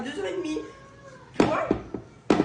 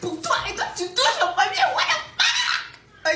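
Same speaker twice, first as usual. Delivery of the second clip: rising to a high laughing squeal; mock outrage, cracking up into laughter; high, laughing what the fuck; indignant outburst; 6 dB louder, slower than usual.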